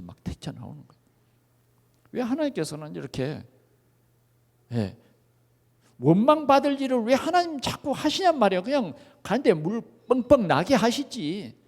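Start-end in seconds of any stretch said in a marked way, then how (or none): none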